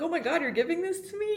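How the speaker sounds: high, quick disbelief; retold amazement. Retelling being handed a favorite cinnamon bun, high amazed voice.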